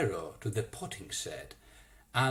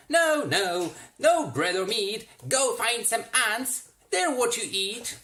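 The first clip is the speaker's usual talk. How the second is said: loud refusal; 9 semitones higher, 6 dB louder than usual. A narrator's reading. Roy refuses the hungry anteater.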